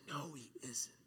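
sinister whisper of an inner doubt. Acted. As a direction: hiss it like a voice in your head. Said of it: "And the doubt says".

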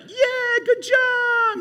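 high cheery good job; cheering someone on. Retold or imitated. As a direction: chirp it out like an eager cheerleader. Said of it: "for you guys to be like".

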